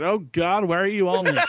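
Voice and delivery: hammed-up horror; mock shock.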